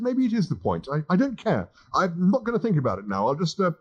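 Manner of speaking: lightly voiced frazzled young man; flustered Arthur. Arthur giving up.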